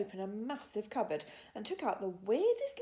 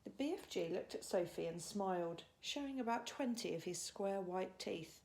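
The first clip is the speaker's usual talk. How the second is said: ordinary delivery, nothing marked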